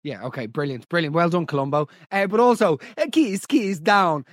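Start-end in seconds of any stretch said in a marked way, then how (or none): 3.1-4.2 put-on slow, drawn-out "keese keese down" in Italian accent; mock Italian accent. Host mocking a caller's quote, Italian accent. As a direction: mimic a bad Italian accent. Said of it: "You can't do the accent".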